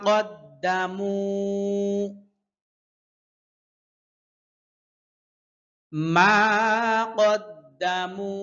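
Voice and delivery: slow, measured tajweed recitation; Quran recitation. Shown as a demonstration. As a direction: chant each word slowly. (ধীরে তাজবিদসহ কুরআন তিলাওয়াত)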